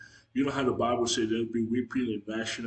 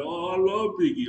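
much higher and louder, a mock wailing cry; 8 semitones higher, 6 dB louder than usual. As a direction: let it break out like a lament at a funeral. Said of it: "Like, he kept crying".

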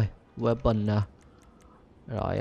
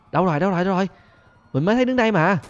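higher, louder quick questions; urgent searching; 6 semitones higher, 5 dB louder, faster than usual. Real, not acted. Looking for a cop, urgent calling. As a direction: hunt for the target.

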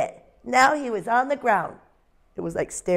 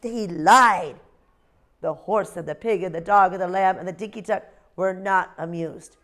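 usual speaking voice

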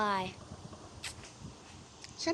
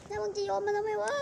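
annoyed character voice; 8 semitones higher than usual. Acted. Character snaps at the thrower.

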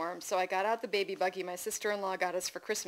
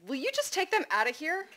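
high, exasperated demand; 9 semitones higher than usual. A narrator's reading. Frazzled mother to husband on Mother's Day, exasperated. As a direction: plead at a high pitch for relief.

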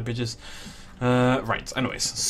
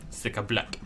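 put-on Russian accent; 3 semitones lower, 6 dB quieter than usual. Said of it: "I have to do a Russian accent".

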